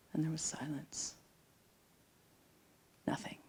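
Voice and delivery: whispered, almost no voice; hushed whisper. Real, not acted. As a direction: drop into a breathless whisper as the silence falls. The world stopped, silence.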